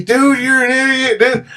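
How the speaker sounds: half-hearted yell at a friend; mock yell. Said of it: "[name]'s yelling at me".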